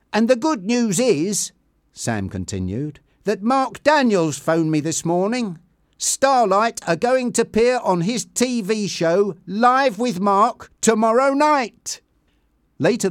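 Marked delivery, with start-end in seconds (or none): 0.1-1.5 much higher, eager lead-up; upbeat and eager; happy. A narrator's reading. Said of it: "he said happily". 3.2-12.0 high, building to loud excitement; delighted manager's big reveal; happy announcement. A narrator's reading. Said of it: "he said happily".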